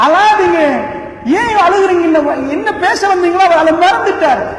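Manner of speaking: high, loud tearful plea; pleading comfort. Acted. Prophet consoling weeping Umar, high loud plea. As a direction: beg him to stop weeping.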